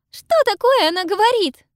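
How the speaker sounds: high, loud, frightened; frightened question. A narrator's reading. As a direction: ask it in fright. Pippa frightened.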